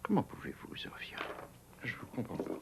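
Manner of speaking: hushed hurt whisper. Acted. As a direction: whisper it, wounded. Hurt, muted reproach.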